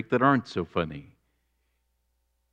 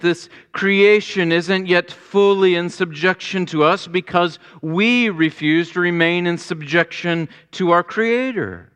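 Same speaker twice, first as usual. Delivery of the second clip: higher and louder, driving sermon climax; preaching at full force; 10 semitones higher, 5 dB louder than usual. Acted. Pastor urging his congregation toward God, full preaching voice. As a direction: preach it out, rising to the peak.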